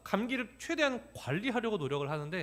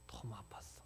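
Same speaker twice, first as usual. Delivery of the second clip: whisper; 10 semitones lower, 19 dB quieter than usual. Real, not acted. Explaining to his son.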